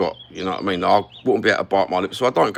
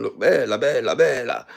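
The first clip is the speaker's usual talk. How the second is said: lightly hammed-up Italian accent; a quick mock Italian, lightly done; playful hint of an Italian accent; Italian impression. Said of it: "like a fucking Italian".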